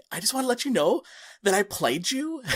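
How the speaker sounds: high, hesitant retold confession; nervous confession. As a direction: blurt it timidly to your hero. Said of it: "I'm, like, super nervous".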